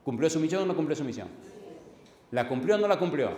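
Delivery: loud challenge. Real, not acted. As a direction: demand an answer from the room.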